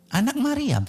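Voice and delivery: high-pitched, retold quick comeback; insistent answer. Answering koko on who Yesus is, insistently. Acting out his debate answer.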